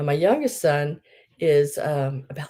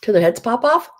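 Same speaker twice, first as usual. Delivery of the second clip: higher, louder and fast playful squeal; mock-fierce gush; 8 semitones higher, 9 dB louder, faster than usual. Real, not acted. Grandma jokes to viewers about squeezing cute babies, loud playful squeal. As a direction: pretend to be overcome by the cuteness. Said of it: "No, I'm just kidding".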